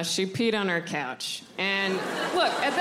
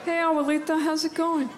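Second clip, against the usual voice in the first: higher, chirpy fake friendliness; awkward cheery greeting; 6 semitones higher than usual. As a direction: greet grandma with a forced smile. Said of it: "I just nod and smile".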